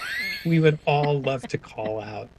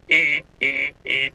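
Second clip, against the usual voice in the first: imitated harsh repeated buzzer; card reader's alarm; 2 semitones higher, slower than usual. Guest imitating a card reader yelling at you, insistent buzzing. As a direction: mimic a nagging machine beep. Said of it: "the reader yelling at you".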